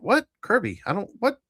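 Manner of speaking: ordinary talk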